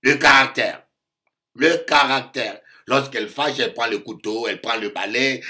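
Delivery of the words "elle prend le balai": normal talking voice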